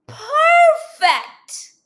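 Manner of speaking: high, loud "perfect"; delighted. Acted. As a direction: cheer the ready balloon. Balloon is ready.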